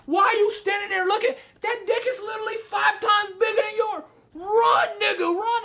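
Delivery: high-pitched exasperated shouting; hammed-up incredulous yelling; yelling at the screen. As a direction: frantically urge him to flee.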